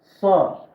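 Sa said thick and loud, tongue pulled back; heavy sa. Shown as a demonstration. Teacher demonstrating the thick Arabic letter sa. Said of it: "kalın".

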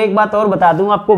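ordinary talk, nothing marked

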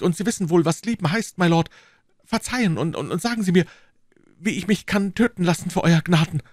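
high-pitched, fervent pleading; young musketeer, apologetic. Acted. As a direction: beg forgiveness, stumbling over your words.

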